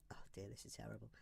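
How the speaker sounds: low, hushed self-critical mutter; muttered aside. Real, not acted. Teacher despairing at their own pen writing, muttered aside. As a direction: grumble it softly under your breath.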